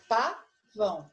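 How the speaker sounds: "pavão" slowly, split into pa and vão; sílaba por sílaba. Shown as a demonstration. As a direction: break "pavão" into its two syllables. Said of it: "uma das sílabas". ("pavão" devagar, separando pa e vão)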